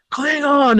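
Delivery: high, sharp mock shout of one word; mock Klingon cry. Grant spotting the Klingon, high mock cry. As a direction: blurt it like a startled crewman. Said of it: "Grant is like".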